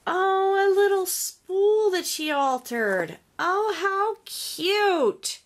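high, loud cooing delight; gushing delight. Real, not acted. Crafter finds an altered spool, gushing with delight. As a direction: gush over it, drawing out the cute. Gushing over a handmade gift.

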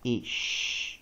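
ish with a long, hushing sh; short i, then stretch the sh in ish; sound-blending demonstration. Shown as a demonstration. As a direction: ish said alone, the sh held. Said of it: "所以我们就念".

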